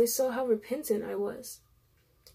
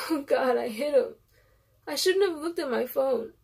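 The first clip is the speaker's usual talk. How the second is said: tearful, higher sobbing confession; crying; 4 semitones higher, 3 dB louder than usual. A narrator's reading. Narrator confessing to the father through tears, sobbing. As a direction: sob it out, overcome with guilt.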